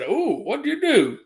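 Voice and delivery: high, surprised imitating of a coworker. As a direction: mimic her shocked "ooh". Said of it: "she said".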